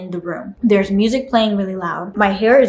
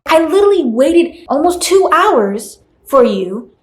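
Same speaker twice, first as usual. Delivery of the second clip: higher-pitched, indignant disbelief; indignant confrontation; 6 semitones higher than usual. Acted. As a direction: confront the hairstylist, pitch climbing in exasperation. Acted confrontation with the hairstylist.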